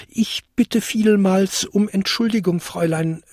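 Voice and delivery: high, flustered little goblin; timid, high-pitched, faltering politeness; stammering apology. A narrator's reading. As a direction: grope haltingly for the proper address. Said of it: "stammelte der kleinste Winterkobold".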